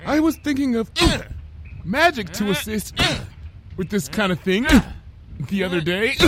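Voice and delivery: high voice broken up by jolts; high-pitched, jolted pauses mid-sentence; musing between headbutts. A narrator's reading. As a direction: muse in short bursts between blows. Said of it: "Lyra mused between headbutts to the plot".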